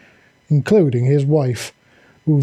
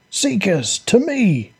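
bellowing; 7 semitones higher, slower than usual. Acted. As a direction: call your troops to you. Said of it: "he bellowed".

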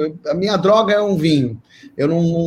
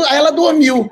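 ordinary talk

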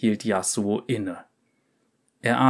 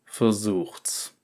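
soft cool dare; calm challenge; quiet taunt; 4 dB quieter than usual. Acted. Swordsman taunts guards.

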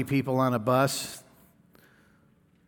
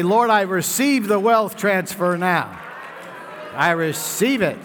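louder, higher declaration; declaring shout; 6 semitones higher, 5 dB louder, slower than usual. Acted. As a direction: proclaim it like a faith confession. Preacher declares receiving wealth.

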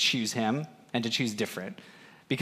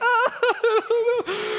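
high laugh; 3 dB louder than usual. Real, not acted. Laughing at retold scene.

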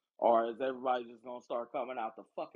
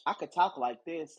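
higher, louder mimicry of put-on talk; sassy imitation of someone's put-on accent; imitating Honey Boo Boo; 6 semitones higher, 7 dB louder than usual. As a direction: push the pitch up for a mocking quote. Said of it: "and was like".

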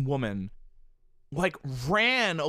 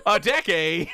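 mock taunt; 5 dB louder than usual. The woman's taunt again.

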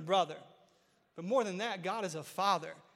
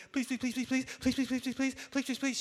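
put-on begging child; 4 semitones higher than usual. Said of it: "She's like".

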